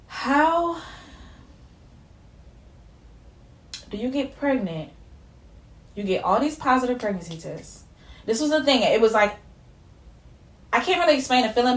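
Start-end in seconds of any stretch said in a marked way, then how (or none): 0.1-7.8 bewildered. Retold or imitated. Telling viewers how she felt back home, bewildered and halting. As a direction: falter in disbelief, with long pauses. Bewildered, near tears retelling own question.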